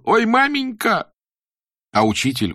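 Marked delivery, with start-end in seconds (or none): 0.0-1.1 high, loud wail of pain; pained cry. Acted. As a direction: cry out under the whip. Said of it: "наконец закричал".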